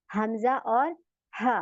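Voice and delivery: slow, drawn-out letter names; slow demo. Shown as a demonstration. Teacher saying the letters slowly for students. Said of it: "अब दोनों के same मखारिज है".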